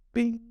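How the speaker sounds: high sustained bell bing; sharp ding held on one high note; imitated bell ring. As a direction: mimic a single bell toll.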